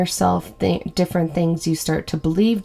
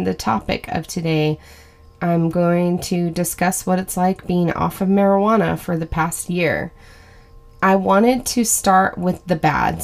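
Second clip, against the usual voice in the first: ordinary talk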